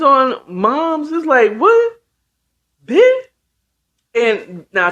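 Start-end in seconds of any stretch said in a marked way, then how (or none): 1.6-3.2 very high, punched one-word questions; outraged disbelief. Retold or imitated. Toy turning on Moms, acted-out disbelief. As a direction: squeak it out in mock outrage, louder.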